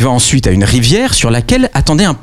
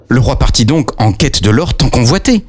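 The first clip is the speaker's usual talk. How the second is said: ordinary talk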